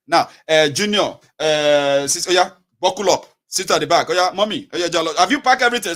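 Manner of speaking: loud, businesslike orders after prayer; brisk parent. Retold or imitated. Parent getting children into the car, loud and brisk. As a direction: call out instructions loudly to the family.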